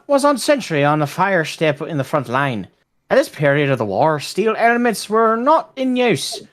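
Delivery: put-on Irish accent, slightly stumbling. Reading a war anecdote to friends, Irish accent. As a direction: read it out in a rough Irish accent. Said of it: "I'll do an Irish accent".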